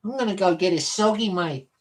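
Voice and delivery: jokey Aussie bloke off to catch a fish; Australian accent. Pretend. Streamer repeating his Aussie impression for chat, Australian accent. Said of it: "Did you like my Australian impression?".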